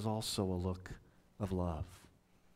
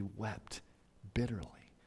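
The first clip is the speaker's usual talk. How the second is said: whispered "bitterly"; 2 semitones lower, 8 dB quieter than usual. Acted. Preacher retelling Peter's weeping, whispered. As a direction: breathe "bitterly" as a whisper, with a fall.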